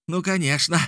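high, eager yell; yelling agreement. A narrator's reading. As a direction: agree at the top of the register. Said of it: "орал Мишель". (восторженно орать)

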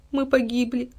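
soft, high, hopeless; weak despairing murmur; barely audible moan. A narrator's reading. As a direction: moan faintly, all strength gone. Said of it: "едва слышно простонал мальчик".

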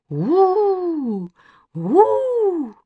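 long high spooky howl; drawn-out ghostly "ooo". A narrator's reading. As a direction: howl like a beast in the dark. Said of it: "Костя завыл в темноте".

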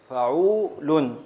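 فعول drawn out very slowly, each syllable held; slow syllable-by-syllable scansion. Shown as a demonstration. Teacher stretching the foot to show its beats to the student. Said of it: "حركة، سكون".

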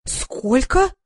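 aghast at the price; shocked gasp. A narrator's reading. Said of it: "ахнула я".

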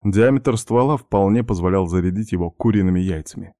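ordinary delivery

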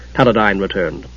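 plain reading voice

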